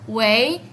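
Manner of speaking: "wei" drawn out, lifting at the end; phone-answering "wei" that slides upward; muy lento y largo. Shown as a demonstration. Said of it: "muy lentamente y muy larga". («wei» alargado con tono ascendente)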